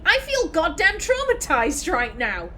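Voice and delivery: exaggerated jokey breakdown; mock traumatized Naomi. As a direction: overplay the trauma for laughs. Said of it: "she was just like".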